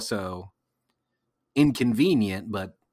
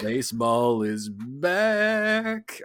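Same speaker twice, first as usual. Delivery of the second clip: slow reverent echo; 2 semitones higher, much slower than usual. Acted. Echoed slow reverent repeat.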